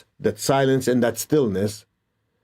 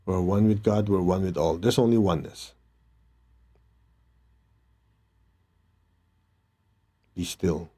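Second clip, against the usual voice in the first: calm meditation guide voice; guided meditation. Acted. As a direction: lead them inward, gently. Leads a meditation.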